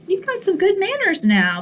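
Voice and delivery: usual speaking voice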